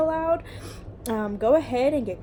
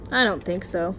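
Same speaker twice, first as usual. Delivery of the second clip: ordinary delivery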